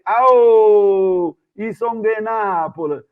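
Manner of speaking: long, loud drawn-out "Au"; retold loud call. Tourist in Rome fends off a street hustler, loud retold call. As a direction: holler it out, stretching the vowels.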